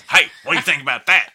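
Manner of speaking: imitating him, high and loud; retold loud demand. Musician imitating his bandleader pressing him about the scene, loud demand. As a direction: call it out like an insistent showman.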